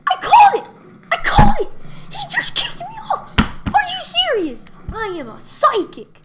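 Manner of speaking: very high, loud gloating yell; real shouted disbelief and glee; triumphant shouting. Real, not acted. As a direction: crow that you called it. Prediction comes true.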